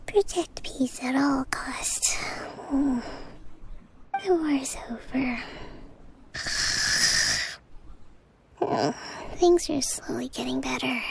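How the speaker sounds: murmured whisper in her sleep; sleep talking. Acted. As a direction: drift through the words half-asleep. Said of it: "she's fallen asleep".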